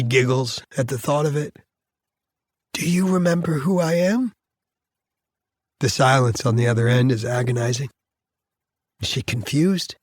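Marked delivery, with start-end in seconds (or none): 2.7-4.3 put-on higher, tender question to a child; gentle daddy voice. Father unsure his daughter remembers him, high gentle voice. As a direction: ask a small child carefully.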